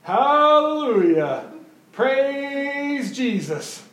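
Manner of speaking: drawn-out, high, loud hallelujah calls; happy churchgoer praising aloud, slow and high; imitating his hallelujahs. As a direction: stretch each word out like a joyful shout. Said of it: "he would constantly be walking around going".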